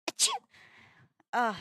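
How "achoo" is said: small sneeze; sudden little sneeze; real sneeze. Real, not acted. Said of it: "little sneezes".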